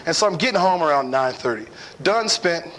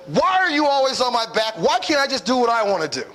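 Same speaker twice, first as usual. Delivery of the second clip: mock defensive complaint; 6 semitones higher than usual. Angry husband in argument.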